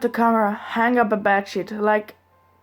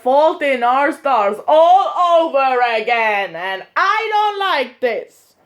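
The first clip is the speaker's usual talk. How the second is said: high, loud, drawn-out mock wail; 5 semitones higher, 6 dB louder, slower than usual. Vlogger reacting as if to The Fault in Our Stars, mock wailing. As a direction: wail it slowly, hammed-up despair. Mock distress over a sad scene.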